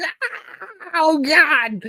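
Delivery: high anguished cry, swelling to a loud oh God; a very high, hammed-up yell of pain; pained scream. Shown as a demonstration. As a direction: show how a real scream in pain should sound.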